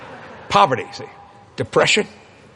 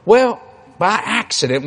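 ordinary delivery

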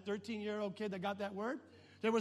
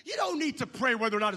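shouting; 7 semitones higher, 8 dB louder than usual. Retold or imitated. Wife shouting reminder.

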